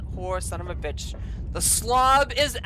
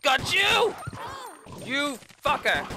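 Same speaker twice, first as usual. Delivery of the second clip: high, startled outburst; startled yell; 5 semitones higher than usual. Real, not acted. Player catching an attacker, startled yell. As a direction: yelp it in real alarm.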